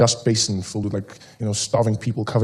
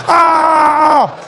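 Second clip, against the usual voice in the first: high, loud hammed-up scream; mock battle yell; 8 dB louder than usual. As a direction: fake a furious charge.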